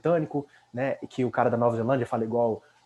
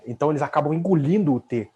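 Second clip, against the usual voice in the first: ordinary delivery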